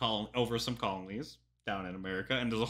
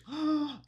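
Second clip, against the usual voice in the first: quick high gasp of surprise; a startled intake of breath; sharp gasp. Real, not acted. Reacting to the video, a high gasp.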